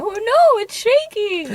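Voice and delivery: very high, loud cartoon panic; hammed-up high-pitched alarm; mock panic. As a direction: squeal it like a badly faked disaster victim. Voices building people panicking in quake.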